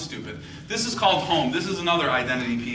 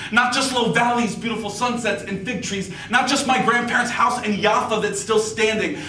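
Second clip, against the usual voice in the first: louder, higher poetry recitation, steady and earnest; spoken word poem; 12 semitones higher, 10 dB louder than usual. Acted. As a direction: perform it like a slam poem about home. Performs a second spoken word poem.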